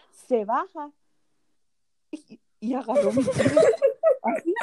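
high, laughing telling. Real, not acted. Laughing as she tells how he grabbed her foot. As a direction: laugh through the awkward part.

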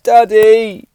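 put-on high, loud whining little girl; high whiny child impression; whiny daughter. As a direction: mimic a sulky child complaining. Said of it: "She's like".

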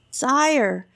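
the fussy artist objects to the king, raising his voice; artist protesting. A narrator's reading. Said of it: "protested the artist".

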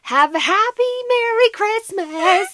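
slow, high, cheery greeting; cheery singsong. Retold or imitated. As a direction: chirp it brightly. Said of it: "just like".

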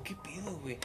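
hushed whisper of disbelief. Retold or imitated. Walking home seeing chaos, whispering to himself. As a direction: breathe it out under your breath. Retelling own puzzled reaction.

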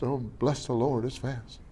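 quiet, amused blessing; hushed aside. Acted. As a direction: drop to a near-murmur of delight. Aside about fast car.

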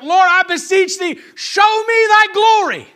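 loud, high cry to heaven; acted, desperate shouted prayer; frustrated prayer. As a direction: plead at full voice, stretching the final words. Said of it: "at one time he's praying".

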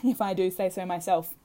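usual speaking voice

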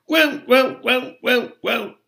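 high, loud elf croaking; continued croaks. A narrator's reading. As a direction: keep croaking shrilly over everyone. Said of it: "Creature's continued croaks".

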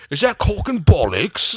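jokey British voice correcting himself; mock British. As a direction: ham up a British accent on bollocks. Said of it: "Are you British?".

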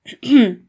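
loud, deliberate throat clear; officious ahem. A narrator's reading. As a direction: clear your throat before a formal address. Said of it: "صداشو صاف کرد".

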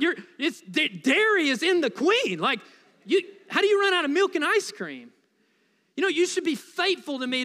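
mock-furious complaint about no ice cream; mock outrage. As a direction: play up the betrayal, comically upset. Outrage at Dairy Queen being out.

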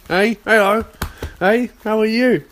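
high, loud pet-greeting voice; excited hello to a dog; talking to dog. Real, not acted. Greeting his dog Ollie.

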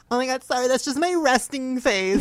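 high, loud, slowed apology; apologetic mean girl. Retold or imitated. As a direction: gush a fake-sweet sorry. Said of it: "And she was like".

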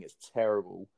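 ordinary talk

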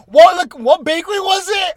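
high, loud mock sister blurting a question; imitating intruding sister. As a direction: burst in with a shrill-pitched, oblivious question. Sister bursts in mid-hookup asking.

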